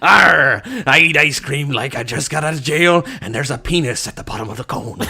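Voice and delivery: loud, growled "arr" pirate voice. Shown as a demonstration. Player doing a pirate on request, loud pirate voice. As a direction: bellow it like a pirate, easing into laughter. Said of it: "do pirate".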